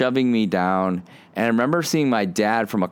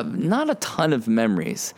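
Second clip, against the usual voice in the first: normal talking voice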